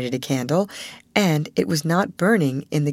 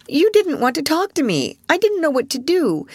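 tearful reproach; 11 semitones higher, 3 dB louder than usual. A narrator's reading.

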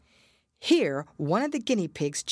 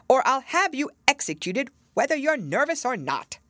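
higher, louder angry threat; 9 semitones higher, 5 dB louder than usual. A narrator's reading. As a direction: threaten execution. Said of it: "the king repeated angrily".

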